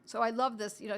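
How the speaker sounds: usual speaking voice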